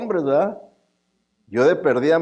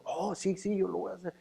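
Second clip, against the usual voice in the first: higher and much softer, a breezy light promise; mock eager believer; 5 semitones higher, 11 dB quieter than usual. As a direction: toss off the promise lightly, all fleeting enthusiasm. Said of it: "la emoción del momento".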